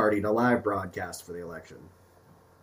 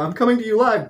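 shaky-cam reporter; 6 semitones higher than usual. Pretend. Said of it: "like one of those scary movies".

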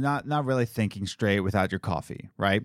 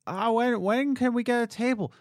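high mock customer voice; lightly voiced impatient customer; mock pushy lady; 11 semitones higher than usual. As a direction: nag in a pitched-up put-on voice.

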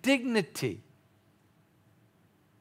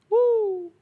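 excited whoop; 5 dB louder than usual. Real, not acted. Preacher whoops after point.

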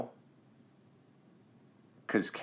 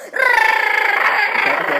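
sudden high shouted cut-off; loud okay; 11 dB louder, much slower than usual. Real, not acted. Dad loudly interrupting his son's impression. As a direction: stop him with a loud yelp.

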